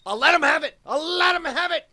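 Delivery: high yelled ultimatum; desperate threat. Acted. Captor demanding to see the Emperor, yelled threat. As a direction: scream it like you mean it.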